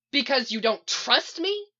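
high, loud, hurt question; heated, rising challenge; angry challenge. A narrator's reading. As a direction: throw the accusation back. Shouted quarrel between Iris and Barry.

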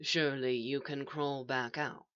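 low, soft, patient reasoning; reasonable. A narrator's reading. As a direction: reason with them quietly. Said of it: "the voice said reasonably".

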